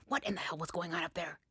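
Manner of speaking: hushed, fierce scolding; whisper shout. A narrator's reading. Mom scolding her daughter in the kitchen, hushed and fierce. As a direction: hiss it at her, furious but hushed. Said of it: "she whisper shouts at me".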